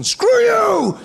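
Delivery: high, loud shout; slamming down a landline, loud high yell; mock phone yell. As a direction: yell it as you slam the phone. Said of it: "where you get to tell the person".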